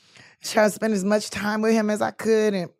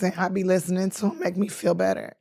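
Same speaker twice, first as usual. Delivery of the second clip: normal talking voice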